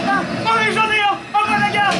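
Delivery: high startled alarm yell; panicked shout. Acted. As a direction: cry out in sudden panic.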